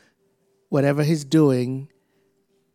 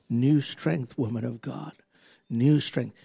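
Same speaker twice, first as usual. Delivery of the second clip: ordinary talk, nothing marked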